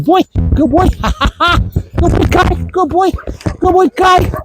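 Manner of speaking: high-pitched, playful praise with a laugh; excited dog praise. Real, not acted. Trainer rewarding his dog Kai, excited praise. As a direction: gush over a dog in a high voice.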